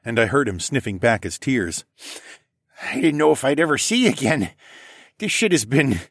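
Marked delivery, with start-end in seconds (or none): 2.8-6.0 lightly voiced dad, raised pitch; emotional dad. A narrator's reading. Dad hugging his returned son, choked up. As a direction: hold back tears as you speak, voice lifted.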